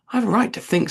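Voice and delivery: sharply. A narrator's reading. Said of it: "said Alice sharply".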